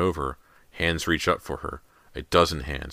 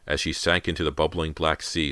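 ordinary delivery, nothing marked

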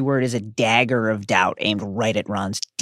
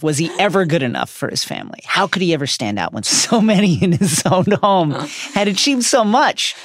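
normal talking voice

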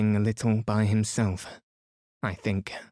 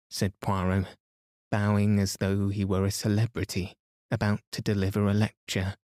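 usual speaking voice

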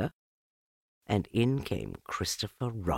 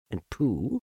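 plain reading voice

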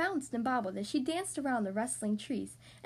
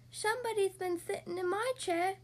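high little bear-cub voice; small, high, childish cub; Baby Bear yelp; 7 semitones higher than usual. A narrator's reading. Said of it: "yelped Baby Bear".

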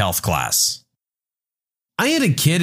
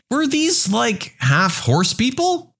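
put-on high, slow, hesitant kid voice; higher-pitched confused schoolkid; puzzled student; 8 semitones higher, slower than usual. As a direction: ask haltingly, pitched up like a pupil. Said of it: "a student raised their hand".

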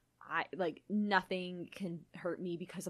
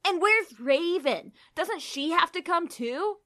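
put-on whiny teen boy; 9 semitones higher, 6 dB louder, slower than usual. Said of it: "make them obnoxious teen boys".

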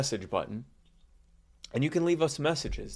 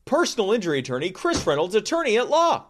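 rising announcer build to a big boom; announcer hype; 10 semitones higher, 7 dB louder than usual. Acted. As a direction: hype up the sponsor name like a ring announcer. Sponsor plug, name boomed.

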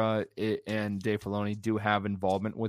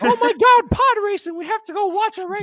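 excited mock character; 8 dB louder than usual. Mocking characters excited about podracing.

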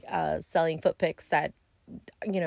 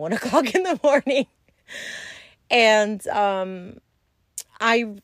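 laughing, quick and a bit higher; 3 semitones higher, 4 dB louder than usual. Real, not acted. To viewers, about the client waiting up, laughing. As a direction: giggle through it.

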